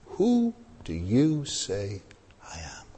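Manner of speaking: whispered, slow and intimate; hushed question. Retold or imitated. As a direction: lean in and breathe the question. Jesus' searching question, hushed.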